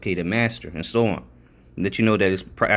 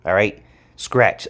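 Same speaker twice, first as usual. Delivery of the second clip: ordinary delivery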